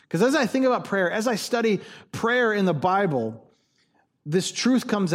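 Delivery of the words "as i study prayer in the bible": ordinary talk, nothing marked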